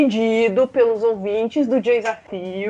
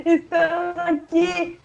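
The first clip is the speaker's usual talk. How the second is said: announcer voice; 7 semitones higher, slower than usual. Acted. Host announcing the show.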